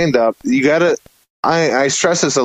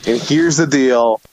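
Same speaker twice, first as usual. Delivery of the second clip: ordinary talk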